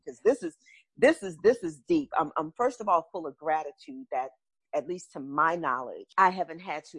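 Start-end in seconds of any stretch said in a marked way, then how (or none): 0.0-2.1 emotional break. Real, not acted. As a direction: voice breaking upward. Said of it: "I'm really emotional right now".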